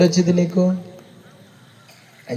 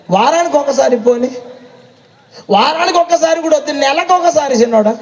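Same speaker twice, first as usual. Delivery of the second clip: mock bargaining, higher; 9 semitones higher than usual. Mocking bargaining down.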